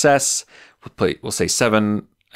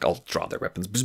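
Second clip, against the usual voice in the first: ordinary talk, nothing marked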